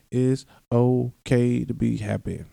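slow, stretched-out words of comfort; slow reassurance. Real, not acted. Podcaster giving listeners permission, slow reassurance. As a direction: draw out OKAY and take your time.

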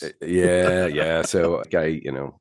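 hoarse croak from a cold; a little croak in the voice; croaky voice. Real, not acted. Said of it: "did I hear a little croak in your voice?".

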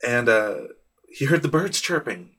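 ordinary talk

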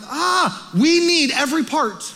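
light up at the discovery; sudden realization. Retold or imitated. Pastor retells hurting his knuckle, mock aha.

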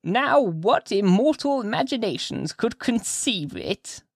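put-on high, drawn-out old woman's voice; slow, high-pitched old gossip taking someone into her confidence; old lady confiding. A narrator's reading. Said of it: "whispered the old lady confidently to Hester".

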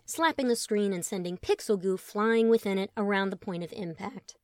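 usual speaking voice

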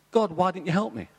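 man in heaven reproaches God, mock indignant; aggrieved complaint to God. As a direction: demand an answer from God.

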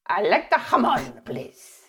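hammed-up Spanish accent, starting high. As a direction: put on theatrical Spanish, laughing at the end. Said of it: "I'm better at Spanish".

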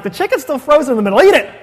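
laughing, fast and high; laughing mock complaint. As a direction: tease it through a laugh. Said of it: "My kids are like".

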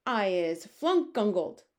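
loud slow screech; slow, loud screeching giant; giant's screech. Acted. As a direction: screech it out like a furious brute. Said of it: "screeched the maid masher".

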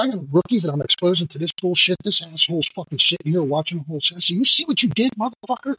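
indignant high-pitched rant, retold; self-righteous outrage without shouting; losing it. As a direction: re-enact chewing someone out, voice climbing high. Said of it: "Oh, I lost my shit".

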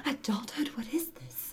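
high, breathless, whispered gasp; mock gasp. Joking to cohost about adulthood, a breathy high mock gasp. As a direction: gasp high and airy, like shocked. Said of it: "gasp at adulthood".

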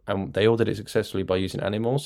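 normal talking voice

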